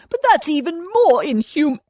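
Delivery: loud, high outburst cut short; indignant protest. A narrator's reading. Cornelius objecting to an earlier deadline, indignant. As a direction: squawk it indignantly until interrupted.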